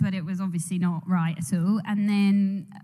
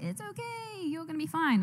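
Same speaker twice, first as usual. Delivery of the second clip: higher, softer mum voice reassuring her daughter; a hint of a comforting mum, higher and soft; positive mum; 8 semitones higher, 8 dB quieter than usual. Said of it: "trying to be a positive mum, be like".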